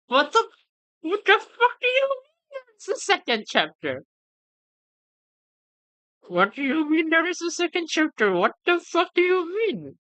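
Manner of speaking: high, strained cries of disbelief; shocked disbelief. Real, not acted. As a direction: yelp it out, unable to believe it. Shocked there is a second chapter.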